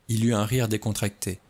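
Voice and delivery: plain reading voice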